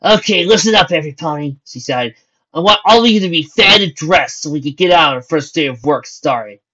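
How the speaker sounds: shouting orders. Acted. Lucy shouting orders to every pony, loud. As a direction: call the group to attention. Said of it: "Lucy started shouting".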